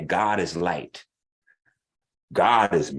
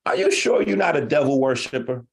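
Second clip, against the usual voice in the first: a sharp, raised interrogation; pointed challenge; 6 semitones higher than usual. Retold or imitated. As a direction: press him on whether he's sure. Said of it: "I'm like".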